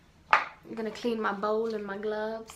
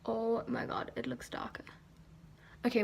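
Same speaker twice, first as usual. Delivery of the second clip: normal talking voice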